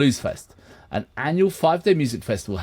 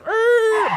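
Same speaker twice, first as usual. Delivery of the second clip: screeching brakes noise; 4 dB louder than usual. Retold or imitated. Braking to a halt.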